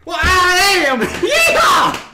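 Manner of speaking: loud, high Southern whoop; rowdy Southern cowboy yeehaw; Southern yell. Acted. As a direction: holler it out in a big Southern accent. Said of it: "I'm not from the South".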